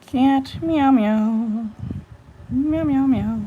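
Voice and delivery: high, very slow meowing; cat voice. Pretend. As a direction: meow it like a little cat. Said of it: "this cat voice".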